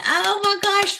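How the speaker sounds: high, squealing oh my gosh; thrilled squeal. Real, not acted. Thanking a new subscriber, squealing with joy. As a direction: gush with real delight.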